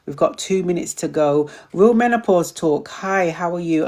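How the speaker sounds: ordinary delivery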